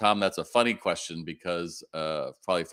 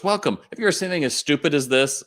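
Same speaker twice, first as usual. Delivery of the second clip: chirpy greeting; 8 semitones higher, 5 dB louder than usual. Retold or imitated.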